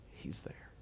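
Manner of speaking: soft, voiceless whisper. Shown as a demonstration. Preacher telling listeners the Spirit is there, whispered. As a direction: drop right down to a whisper. Enacts hearing God's whisper.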